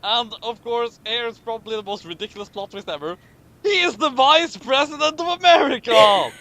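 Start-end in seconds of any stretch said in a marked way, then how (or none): 3.6-6.3 mock dramatic reveal. A narrator's reading. Reveals ridiculous plot twist.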